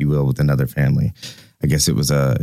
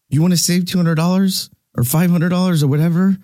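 inner rant; 11 semitones higher than usual. Retold or imitated. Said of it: "I'm like".

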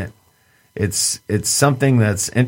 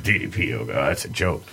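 mocking yogi voice; 7 semitones lower, 4 dB quieter than usual. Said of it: "they are like".

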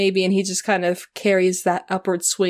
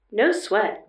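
bright; 2 semitones higher than usual. Acted. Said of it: "the Ice Princess replied brightly".

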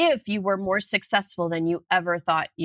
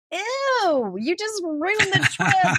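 mock disgust; 10 semitones higher than usual. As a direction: play your own horror at the word budget. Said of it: "And I'm like".

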